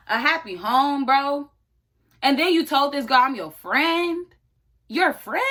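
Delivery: indignant. Real, not acted. Outraged at the cheater.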